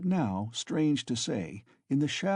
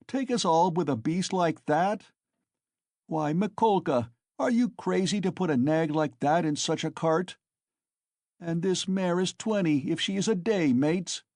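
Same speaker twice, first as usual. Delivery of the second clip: mocking exclamation; 7 semitones higher than usual. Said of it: "outbreak of laughter and exclamations".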